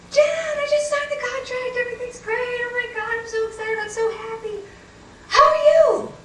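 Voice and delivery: very high squealed gushing; mock excited brother. Brother calling with his big news, mock excited. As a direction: imitate a brother bursting with good news, hammed-up. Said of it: "was all excited".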